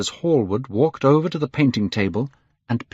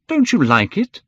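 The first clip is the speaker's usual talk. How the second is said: high, louder eager question; cried out question; 11 semitones higher, 5 dB louder than usual. A narrator's reading. Painter to his silent sitter, crying out. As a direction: cry it out, pitched well up. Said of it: "cried Hallward".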